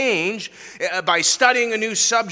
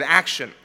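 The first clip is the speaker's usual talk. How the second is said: action set apart and weighted; stress on action; 4 semitones lower than usual. Shown as a demonstration. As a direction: lean on action. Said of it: "with the emphasis on that second word".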